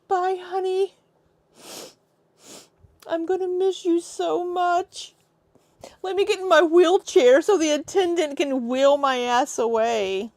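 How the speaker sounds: high-pitched pretend weepy farewell; mock tearful goodbye. Mocking her airport farewell to her boyfriend, fake tearful. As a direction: draw out a hammed-up lovesick goodbye.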